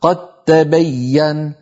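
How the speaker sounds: slow qattabayyan for قد تبين; merged when joined. Shown as a demonstration. Teacher showing joined reading, doubled t. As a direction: drop the d of قد into a doubled t. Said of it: "لكن لو وصلنا".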